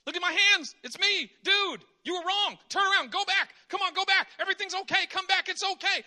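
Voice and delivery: loud, high, pleading call; urgent Jesus. Pretend. Jesus calling the leaving disciples back, loud and pleading. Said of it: "I would just say".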